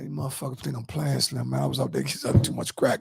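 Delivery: low, hushed rant under the breath; retold grumbling, soft and rushed; agitated muttering. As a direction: mutter it fast, like griping to yourself. Acting out his agitated pacing rant.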